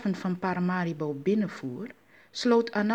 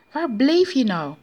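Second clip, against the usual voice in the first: high, loud demand; shrieked reproach, acted; scolding yell; 6 semitones higher, 7 dB louder than usual. A narrator's reading. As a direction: yell it at a late husband. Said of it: "gilde Ma Akuba".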